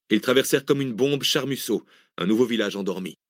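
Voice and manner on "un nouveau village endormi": plain reading voice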